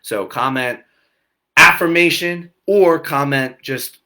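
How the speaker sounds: ordinary delivery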